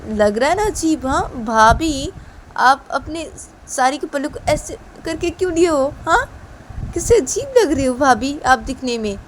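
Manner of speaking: teasing. Acted. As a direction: poke fun at bhabhi. Rahul teases bhabhi about saree.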